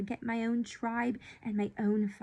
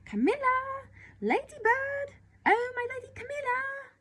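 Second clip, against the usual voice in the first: much higher, much louder, calling from afar; shrill calling nurse; 6 dB louder than usual. Acted. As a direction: cry out like a peahen before rain. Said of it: "shrill as a peahen before rain".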